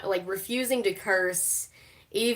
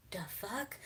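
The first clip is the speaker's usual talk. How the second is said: whispered mock disbelief; baffled whisper; 15 dB quieter than usual. Fan retells reaction to the ring line, baffled whisper. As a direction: whisper it under your breath, baffled.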